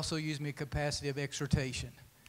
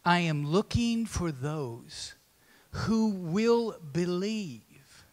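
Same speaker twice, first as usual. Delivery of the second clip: 1 solemn prophetic quote; 3 semitones higher, 4 dB louder, slower than usual. Retold or imitated. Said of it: "He also said".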